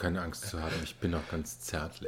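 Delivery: soft, creepy sweet talk; mock tender. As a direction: coo it like a creep. (zärtlich säuselnd)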